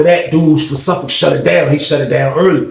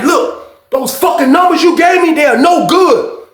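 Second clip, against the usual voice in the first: angry confrontation; 12 semitones higher, slower than usual. Retold or imitated. Confronting over bad numbers.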